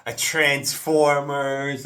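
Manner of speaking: usual speaking voice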